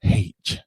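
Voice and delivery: H said as "haitch"; H pronounced "haitch"; British H. Shown as a demonstration. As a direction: deliberately start H with an h sound. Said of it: "counterintuitive to actually pronounce it".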